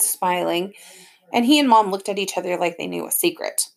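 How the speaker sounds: plain reading voice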